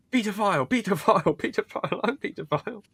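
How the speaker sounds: put-on high voice imitating a character; high-pitched mimicry. Retelling the dinner party scene, high-pitched mimicry. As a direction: repeat it high, in a mock character voice.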